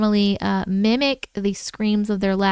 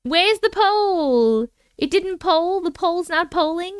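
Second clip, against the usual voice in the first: light Cockney, higher and slow at first; Cockney accent; 6 semitones higher than usual. Pretend. Streamer fretting over a broken poll, mock Cockney. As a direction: play at Cockney while hunting the poll. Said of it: "put a little Cockney on it".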